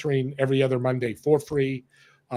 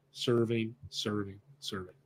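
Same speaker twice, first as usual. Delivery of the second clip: each "serving" softer and lower until it is almost gone; fading repetition; 5 semitones lower, 11 dB quieter than usual. Acted. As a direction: let "serving" die away into silence. Repeated word trailing away.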